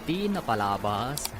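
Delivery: normal talking voice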